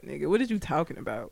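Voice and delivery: ordinary talk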